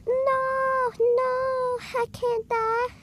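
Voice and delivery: very high, monotone falsetto wail; mock dying girl. Girl pleading as she is beaten, falsetto mock wail. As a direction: hold each word on one high note. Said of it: "And she was like".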